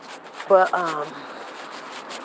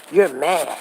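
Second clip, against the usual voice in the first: ordinary talk, nothing marked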